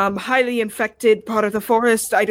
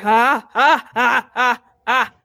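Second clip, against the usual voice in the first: nervous fawn; slower than usual. Acted. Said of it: "just nervous, like".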